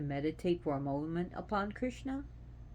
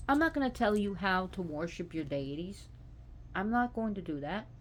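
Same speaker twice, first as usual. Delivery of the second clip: ordinary talk, nothing marked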